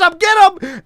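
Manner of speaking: high, loud cheer to buzz; excited yelling. Retold or imitated. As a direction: yell it at the TV. Retelling yelling at TV.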